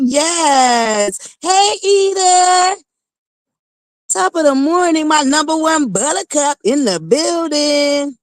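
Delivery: high, drawn-out sing-song name calling; roll call shout-outs. Real, not acted. Livestream host calling out viewer names, high and sing-song. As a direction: greet each viewer like a bright announcer.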